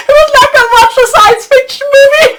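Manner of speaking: high, loud, laughing through the words. Real, not acted. Speaker recalls her adventure to viewers, laughing loudly. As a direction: laugh out the line in delight. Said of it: "laughing retelling".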